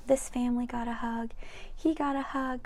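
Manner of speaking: ordinary delivery, nothing marked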